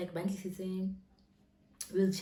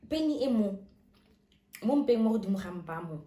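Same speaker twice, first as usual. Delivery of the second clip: pained complaint; 3 semitones higher, 8 dB louder than usual. Retold or imitated. In pain explaining to nurse.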